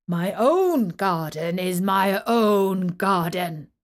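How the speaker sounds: loud, slow selfish giant; a possessive giant laying down the law; low harsh voice. Acted. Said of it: "said the giant".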